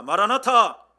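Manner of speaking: rousing Maranatha shout; loud greeting cry. Shown as a demonstration. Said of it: "따라서 하십시다".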